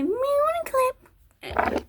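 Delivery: very high, cutesy singsong; silly high-pitched voice. Pretend. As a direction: play with the clip names in a high, drawn-out squeak. Same silly clip voice.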